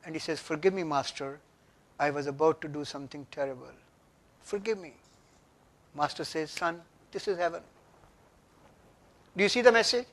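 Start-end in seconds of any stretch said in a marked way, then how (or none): 0.4-4.9 soft, humble apology; apologetic plea. A narrator's reading. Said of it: "he is apologetic". 6.5-7.6 soft, quiet and gentle; calm gentle reply. A narrator's reading. Master calmly answers the samurai.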